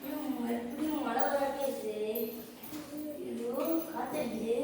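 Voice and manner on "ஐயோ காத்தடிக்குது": ordinary delivery, nothing marked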